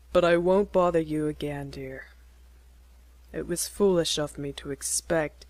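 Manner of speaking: a hint of a low, earnest young suitor; lightly voiced Jack. A narrator's reading. Young man proposing to Kitty, lightly voiced earnest. Jack gently refuses to bother her.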